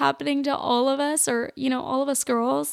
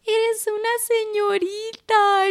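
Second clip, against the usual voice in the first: high, proud and cooing; high cooing praise in Spanish; proud aunt; 9 semitones higher than usual. Retold or imitated. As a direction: gush over the niece like a doting aunt. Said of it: "she's like".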